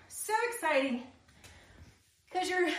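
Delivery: ordinary delivery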